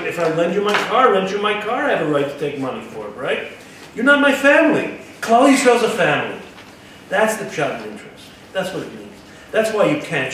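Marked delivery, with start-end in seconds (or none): 4.0-6.4 higher and louder, pressing the point hard; emphatic reply. Retold or imitated. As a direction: declare it firmly to an outsider.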